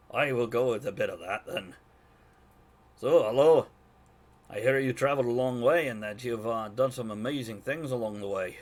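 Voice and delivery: loud, hearty Scottish dwarf; Scottish accent. Acted. [name] greeting travelers who came a long way, in a Scottish accent. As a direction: boom out a loose Scottish-accented welcome. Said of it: "to kick off my Scottish accent".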